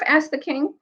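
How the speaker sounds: ordinary delivery, nothing marked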